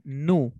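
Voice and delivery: "nu" as a plain "noo"; American pronunciation. Shown as a demonstration. Teacher corrects Geraldine's American answer, "nu" with no y-glide. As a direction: go straight from n to oo in "nu". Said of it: "en americano?".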